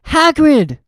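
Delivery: startled call; Harry cries out to weeping Hagrid, shocked. Acted. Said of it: "shocked to see Hagrid".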